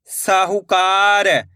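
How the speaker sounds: syllables joined into one slow word; blended word. Shown as a demonstration. Teacher joining the syllables for learners, slowly blended. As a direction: read it as one whole, stretched word. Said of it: "इनको आपस में लाकर पढ़ना है".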